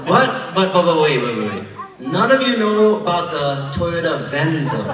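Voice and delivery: ordinary delivery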